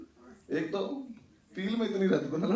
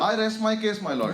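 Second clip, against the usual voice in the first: mock lawyer closing; 5 semitones higher, 4 dB louder, much slower than usual.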